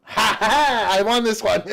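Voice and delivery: laughing loud and high; crowing with glee; triumphant laugh. Real, not acted. Finds himself in video.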